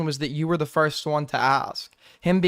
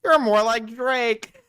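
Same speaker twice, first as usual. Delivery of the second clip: mocking quote; 5 semitones higher, slower than usual. Mocking the streamer's comeback, slow mock singsong. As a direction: parrot the clip's line to make fun of it. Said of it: "Damn, bro, really got him".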